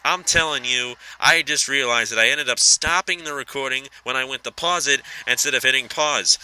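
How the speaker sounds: ordinary delivery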